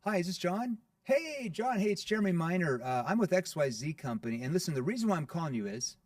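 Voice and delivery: high, bright salesy cold-call pitch; eager phone sales opener; pushy cold caller. Retold or imitated. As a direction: sound like a typical telemarketer. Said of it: "what is the average cold caller".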